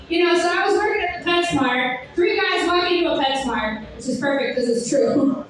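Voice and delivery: normal talking voice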